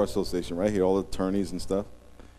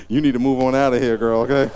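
brushing her off; 4 semitones higher, 8 dB louder than usual. Retold or imitated. His reply to the woman.

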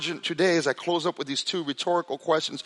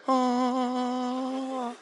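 awed ah; 7 semitones higher, 5 dB quieter than usual. Retold or imitated. Awe on entering guitar room.

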